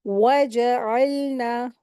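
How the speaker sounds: "वजअलना" drawn out slowly with rounded lips on the waw; slow recitation. Shown as a demonstration. Teacher reciting the verse for students, slow rounded-waw recitation. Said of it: "वाव के लिए होठों को गोल करते हैं".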